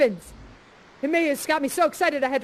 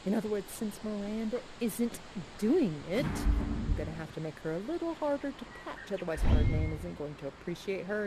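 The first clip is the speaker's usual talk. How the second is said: hushed huddle; 7 semitones lower, 8 dB quieter than usual. Real, not acted. Scheming with the spirits in a huddle, hushed. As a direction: plot quietly with your team. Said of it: "I need a spirit huddle".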